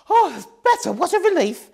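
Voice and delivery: high loud witch voice, relieved. A narrator's reading. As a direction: sigh it out in relief. Jaw freed, relieved witch.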